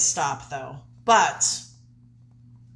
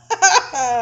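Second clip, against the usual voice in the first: high laugh; 8 semitones higher, 5 dB louder than usual. Real, not acted. Laughs at accent mixup.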